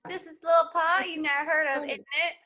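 loud, hammed-up London accent with slang; mock London slang. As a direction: fake Londoner brags at the audition, hammed-up. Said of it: "in your British accent".

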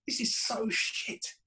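slow breathy under-the-breath mutter; half-whispered slow grumble from a bored punter; mock muttered put-down. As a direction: mutter it slowly to yourself, scornful. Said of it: "retold mutter of the fan".

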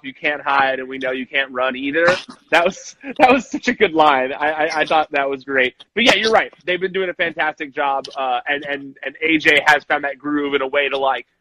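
ordinary talk

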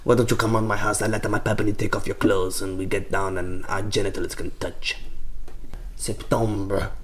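put-on soft seedy French accent. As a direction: purr it like a sleazy Frenchman. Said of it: "your impression of a Frenchman".

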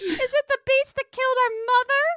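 put-on high, loud child voice; little girl. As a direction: ask like a hammed-up anxious little daughter. Voices the priest's little daughter.

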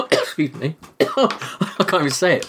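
coughing through the words; forcing words out between coughs; choking. Real, not acted. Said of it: "Just choking while I was trying to say it".

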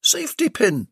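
high eager answer; excited reply. Acted. Belinda answering the plumber's cry, high and eager. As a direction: fire back the matching word. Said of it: "Belinda responded".

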